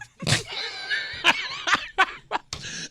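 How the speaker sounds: big shrieking burst of laughter. Real, not acted. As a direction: let a huge laugh burst out. Host cracks up at friend.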